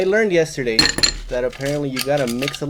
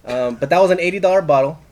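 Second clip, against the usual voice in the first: usual speaking voice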